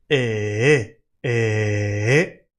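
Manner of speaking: one continuous eh that goes up; gliding eh. Shown as a demonstration. Teacher showing the smooth eh in te estaba. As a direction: hold the eh sound without stopping.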